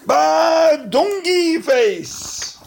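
drawn out. Acted. Drawn-out nonsense.

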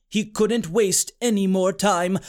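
ordinary delivery

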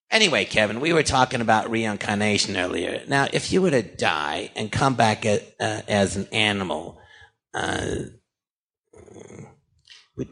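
lightly put-on low character voice; Harvey Fierstein. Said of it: "I really imitate him good".

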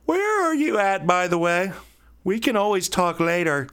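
high, hollow bathroom-echo voice; mock echoing phone call; echoey phone voice. Shown as a demonstration. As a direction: sound like a call from a toilet stall. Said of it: "Yes, I made that sound with my mouth".

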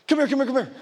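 high, quick mock beckoning; calling someone over. Preacher acts out summoning a homeless person, calling over. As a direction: hail them in a rapid little run.

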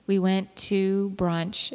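ordinary talk, nothing marked

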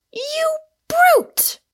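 very high, loud exclamation; outraged cry. A narrator's reading. Rosamond rounds on Michael after his accusations, outraged cry. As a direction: cry out the insult, shocked and stung. Insulted heroine lashes out at Michael.

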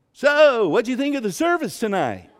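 high, booming imitation of a chipper friend; suddenly loud and high, hammed-up; loud, abrupt question. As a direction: burst into the silence, mimicking him loudly. Friend abruptly breaks agreed silence.

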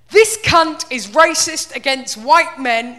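loud, slow mock rant; mock furious comment. Reading the abusive comment in a loud, slow, mocking rant. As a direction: bellow it like an outraged commenter.

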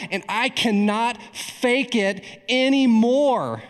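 acting out a woman's fervent breaking point; impassioned confession. Acted testimony of Judy's confession.

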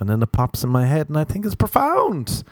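usual speaking voice

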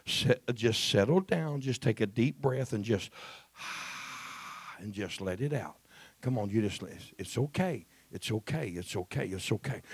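soft, low reassurance; calm her down gently, breath by breath; soothing. Real, not acted. Pastor calming a nervous barefoot sister, softly soothing.

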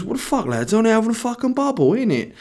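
high, incredulous put-on lad voice; mock protest. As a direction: appeal in a high mock whine. Bubble guy protests to onlookers.

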